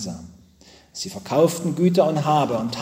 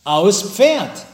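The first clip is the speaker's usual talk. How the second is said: louder, higher, emphatic retort; energetic answer; 5 semitones higher, 5 dB louder than usual. A narrator's reading. Boy insisting to his father on his birthday wish, energetic. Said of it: "der Junge antwortet energisch".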